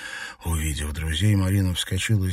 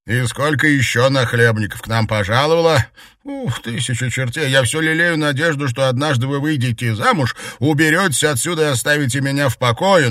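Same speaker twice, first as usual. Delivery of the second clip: loud, raised-pitch complaining; indignant rant; 7 semitones higher, 6 dB louder than usual. A narrator's reading. Maid fed up with freeloading guests, indignant. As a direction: grumble loudly at your employer. Maid rants at her mistress.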